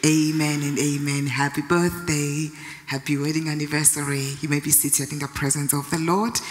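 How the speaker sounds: prayer voice. Acted. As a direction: pray over the celebrants gently. Leads a prayer.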